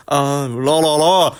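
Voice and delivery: sing-song mock French la la la; tossed-off mock French la la la. Mocking a French fighter with a mock French la la la.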